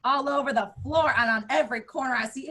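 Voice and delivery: normal talking voice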